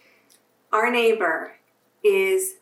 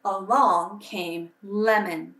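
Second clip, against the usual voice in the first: put-on gentle storybook reading for children; bedtime-story narrator voice; story narration; 5 semitones higher than usual. A narrator's reading. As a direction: read it like a picture book to kids at church. Reading a picture book aloud to children.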